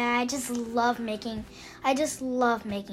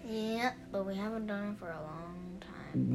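hushed whisper, much softer; 6 semitones lower, 12 dB quieter than usual. Real, not acted. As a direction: breathe it out under your breath. Quiet reluctant reply to father.

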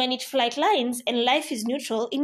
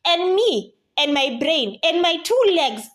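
comic overwhelmed; 5 semitones higher, 6 dB louder than usual. Acted. Pulled every direction.

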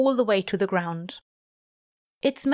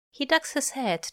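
plain reading voice

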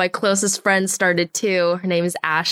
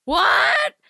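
shocked; 12 semitones higher than usual. Retold or imitated. Retelling her shock.

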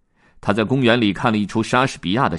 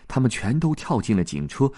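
ordinary delivery, nothing marked

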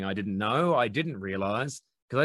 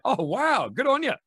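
much higher, gushing oh wow; mock impressed; 14 semitones higher, 4 dB louder than usual. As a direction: gush like someone impressed by tiny muscles. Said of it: "it's sort of like".

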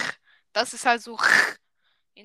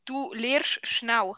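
slow, word-by-word Swiss German; careful Swiss German; much slower than usual. Shown as a demonstration. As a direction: spell out the dialect slowly for a learner. Slow repeat for learner.

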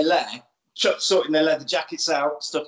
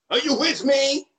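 rallying call; 9 semitones higher than usual. Acted. Rallying the punks.

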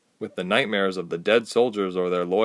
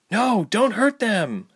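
slow, firm, raised voice; firm plea; 12 semitones higher, slower than usual. Acted. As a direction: stop her with firmness. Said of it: "Ilse said firmly".

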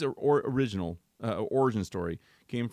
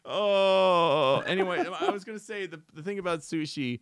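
trying not to laugh; 8 semitones higher, 5 dB louder than usual. Real, not acted. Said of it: "you can't stop making me laugh".

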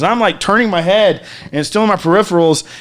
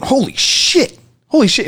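high, stunned repeat; amazed; 8 semitones higher than usual. Retold or imitated. Friend amazed at the free joint, stunned. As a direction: gasp it out in disbelief.

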